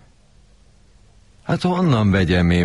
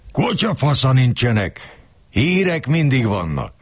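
higher, insistent boss voice; boss's retort; 9 semitones higher than usual. Retold or imitated. Kurilla insisting to the narrator there is always news, higher. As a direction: set him straight, higher. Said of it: "Kurillának".

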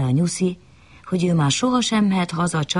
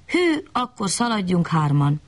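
plain reading voice

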